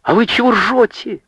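high, indignant challenge; high-pitched angry rebuke; indignant scolding. A narrator's reading. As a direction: round on the lads crossly. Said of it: "Затопала на парней Анка".

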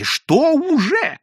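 high, drawn-out begging question; implore, pitch soaring; pleading. A narrator's reading. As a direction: stretch it into an exasperated plea. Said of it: "взмолились сотрудники".

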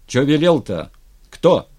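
loud, sharp questioning; irritated commander demanding an answer; impatient interruption. A narrator's reading. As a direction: cut in with loud, curt questions. Ataman impatiently interrupts the messenger.

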